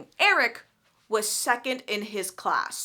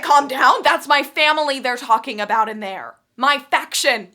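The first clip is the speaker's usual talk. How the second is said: loud, incredulous throw-back of calm down; outraged echo; 6 dB louder than usual. Retold or imitated. Tris hurls Will's words back at him: loud outrage.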